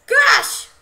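high, loud burst; exasperated yell. Real, not acted. Gamer yelling at the screen, loud and high. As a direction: let it explode out.

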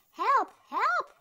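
high, loud cry for help; shrieking. A narrator's reading. Lady spots a floating creature, shrieking. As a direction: shriek it out in alarm, pitch shooting up. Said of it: "she shrieked".

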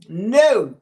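normal talking voice

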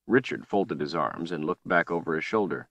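plain reading voice

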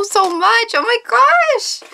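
high, gushing thanks; excited gushing. Real, not acted. Vlogger thanking a sender for ponies, excited gushing. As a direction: gush it high, stretching the last words.

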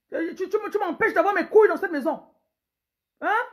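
loud, high demanding husband; bossy husband. Acted. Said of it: "Un pauvre autoritaire". (mari autoritaire qui crie)

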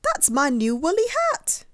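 high, loud, outraged cry; indignant protest. A narrator's reading. Douglas claiming his hat from the bird, indignant cry. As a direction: protest loudly, pitched right up.

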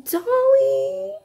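name cried out high in delight; thrilled squeal of a name; excited gasp of a name. Real, not acted. Gasps at the gift.

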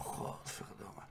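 cursing whisper. Retold or imitated. Jealous princess reading gossip, whispered curse. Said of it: "ze denkt van".

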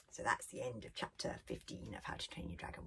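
plain reading voice

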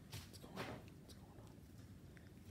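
soft unvoiced whisper, drawn out. Real, not acted. Farmer leaning in to the calves, gentle whisper. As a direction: hush it to the calves.

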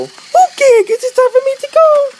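very high put-on toy voice; Mister Lady voice. Mister Lady greets the viewers, very high. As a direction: squeak it like a tiny toy.

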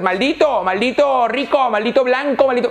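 high, bitter mock cursing; mock resentful insult. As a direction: imitate the envious hater. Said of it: "No le digas". (insulto resentido imitado, agudo)

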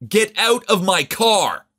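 slow, high, loud yell; angry drawn-out order, pitched high; furious shout. A narrator's reading. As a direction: shout each word out, at the end of patience. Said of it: "So I shouted at the kid".

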